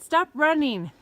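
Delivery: loud call to someone ahead. Real, not acted. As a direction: shout after him to slow down. Calling after partner ahead.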